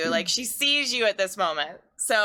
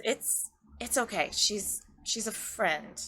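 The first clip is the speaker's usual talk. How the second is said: put-on soft, hushed voice to a shy child; quiet reassurance; 7 dB quieter, slower than usual. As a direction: reassure a little one softly.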